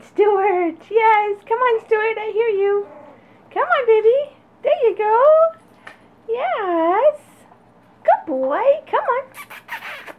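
very high, loud sing-song calling to puppies; puppy calling voice. Real, not acted. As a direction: coax the pups with a squeaky high call. Coaxing puppy Stuart forward.

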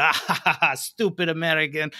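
mock Russian accent, laughing; laughing mock Russian sneer. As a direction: jeer like a Russian crowd member. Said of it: "I always give, like, a Russian accent".

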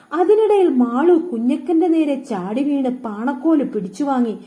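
plain reading voice

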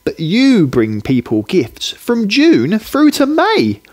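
put-on little boy. Hayden telling Johnny why he is special, high and earnest. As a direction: tell him like an awed small boy. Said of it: "Hayden tells Johnny".

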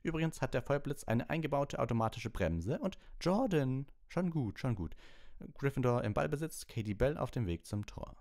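high, rushing play-by-play; schoolboy commentator hyping the Firebolt; eager commentator. A narrator's reading. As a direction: gush about the new broom. Commentator answers the teacher.

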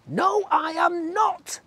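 high, slow defiant shout; tadpole shout. A narrator's reading. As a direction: stamp your foot, refusing. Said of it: "the tadpole said".

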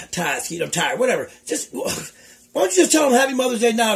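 usual speaking voice

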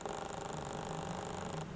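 soft, drawn-out gasp; stunned gasp. Real, not acted. Vlogger seeing highlight on skin, stunned gasp. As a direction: gasp under your breath in awe.

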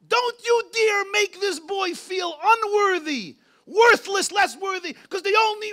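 a fierce shouted warning to the family; furious warning. Retold or imitated. Grandfather defending his grandson before the family, furious slow shout.

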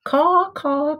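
high cawing crow call; imitated crow caw, high and bright. A narrator's reading.

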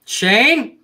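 normal talking voice

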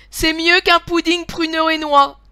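high, loud delighted exclamation; gleeful lady of the house; openly joyful. A narrator's reading. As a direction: let the delight burst out. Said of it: "sans cacher sa joie".

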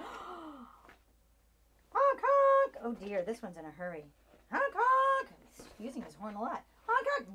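silly clown. Acted. Car in a hurry.

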